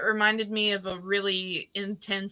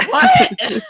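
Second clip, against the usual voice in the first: high, loud shocked laughing what; laughing shocked outburst; 13 dB louder than usual. Retold or imitated. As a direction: blurt it out in disbelief with a laugh. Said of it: "I was just like".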